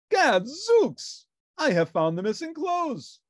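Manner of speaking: loud, high gadzooks exclamation; triumphant detective outburst; Sherlock crying out. A narrator's reading. Detective shouting his find in the bathroom. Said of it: "cried Sherlock".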